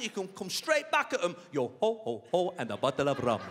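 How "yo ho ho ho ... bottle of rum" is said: low-pitched mock Somali pirate chant; pirate catchphrase in a put-on Somali accent, low. Shown as a demonstration. Comic shows the crowd a pirate comeback, Somali accent. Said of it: "That is how modern day pirate talks".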